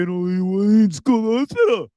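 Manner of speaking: high, loud mock scolding; mock nagging. Host mimics people yelling at him to go, mock nagging.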